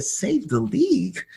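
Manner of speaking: high disbelieving repeat of league; incredulous echo. Retold or imitated. Agent echoing the father's claim in disbelief. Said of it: "Don't we have Michael Jordan?".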